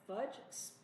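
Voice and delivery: usual speaking voice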